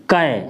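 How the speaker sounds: कै said as "kai", k then ai; drawn-out teaching "कै"; ka with ai matra. Shown as a demonstration. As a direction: model "कै" clearly for pupils. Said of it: "तो हम इसको पढ़ेंगे".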